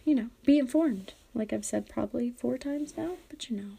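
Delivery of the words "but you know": whispered aside. Real, not acted. Host whispering a self-deprecating aside to listeners. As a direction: drop to a breathy whisper for a quick aside.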